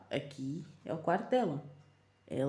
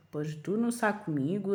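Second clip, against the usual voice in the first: normal talking voice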